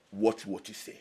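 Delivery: ordinary delivery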